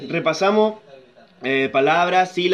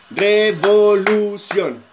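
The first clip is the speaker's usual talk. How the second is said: "Revolución" very slowly, each syllable apart; long, separated beats on "Revolución"; much slower than usual. Shown as a demonstration. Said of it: "a contar las sílabas".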